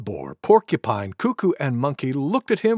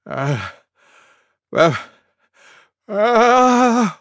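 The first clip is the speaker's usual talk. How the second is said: gasped "ah"s getting higher and louder; sneeze build-up; 6 semitones higher, 5 dB louder, much slower than usual. A narrator's reading. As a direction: wind up for a huge sneeze. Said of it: "went Elephant".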